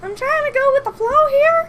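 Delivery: very high, loud cartoon voice; Mickey voice. Shown as a demonstration. Answering friends who call her a weirdo, squeaky Mickey. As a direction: keep up the squeaky mouse falsetto. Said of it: "I got my Mickey voice".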